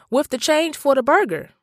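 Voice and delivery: ordinary delivery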